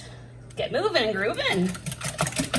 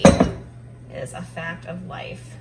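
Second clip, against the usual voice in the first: drawn out slowly, every word weighed; slow emphatic; 3 semitones lower, 7 dB louder, much slower than usual. Real, not acted. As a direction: say it slowly, as a rule of life. Repeating her lesson to kids.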